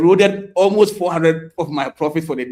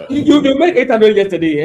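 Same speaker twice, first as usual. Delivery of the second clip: laughing; 7 semitones higher, 7 dB louder than usual. Real, not acted. Laughing at lost profit.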